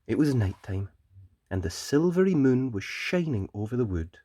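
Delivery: put-on gentle storyteller reading to children; bedtime story narration. A narrator's reading. Narrator reading a bedtime story to children.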